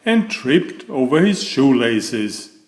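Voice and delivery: ordinary delivery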